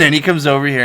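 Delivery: ordinary delivery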